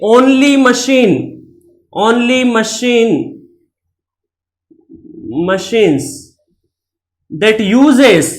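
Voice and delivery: very slow, word by word; slow dictating pace; slow dictation. Shown as a demonstration. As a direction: dictate it slowly for notes. Teacher dictating definition slowly.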